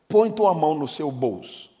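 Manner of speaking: ordinary talk, nothing marked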